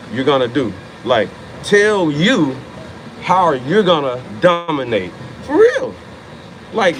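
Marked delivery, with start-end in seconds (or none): 5.4-5.9 sudden high yelp of conviction; excited. Real, not acted. Hyping viewers, a sudden high excited burst. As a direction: jump way up in pitch and punch it. Hyping up his own point.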